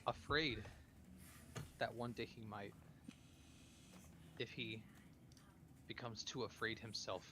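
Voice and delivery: soft, halting confession; hushed, hesitant in-character worry; subdued, worried character. Acted. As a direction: confide a fear quietly, with long pauses.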